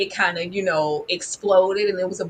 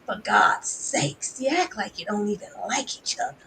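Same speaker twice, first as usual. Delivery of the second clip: imitating Mama Evelyn; 3 dB quieter than usual. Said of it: "one more from Mama Evelyn".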